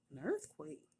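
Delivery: pitched-way-up incredulous query; retold astonishment. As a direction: repeat it in startled disbelief. Mother retells astonished echo question.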